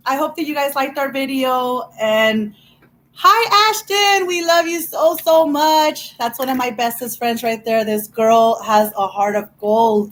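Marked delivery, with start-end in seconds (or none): 3.1-6.2 high, loud shout-out; cheerful high hello to a best friend; excited greeting. Real, not acted. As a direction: call out her name with delight.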